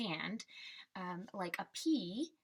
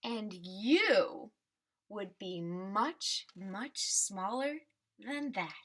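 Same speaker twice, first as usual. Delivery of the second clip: put-on reading to children; 3 dB louder, slower than usual. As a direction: ease the ending down gently, like the book's last page. Teacher reads picture book aloud.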